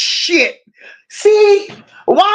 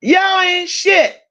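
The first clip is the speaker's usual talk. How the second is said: slow, raised playful put-down; mock scold, higher; 6 semitones higher, slower than usual. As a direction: scold them again, higher and slower.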